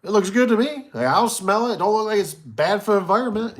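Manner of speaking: high, loud, overly cheerful; mock enthusiastic. Proud plant manager's visitor, mock hearty approval. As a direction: pump it up like an easily impressed visitor. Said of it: "And I thought".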